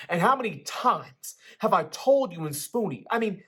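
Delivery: the father lectures his son, voice climbing. A narrator's reading. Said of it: "His voice began to rise".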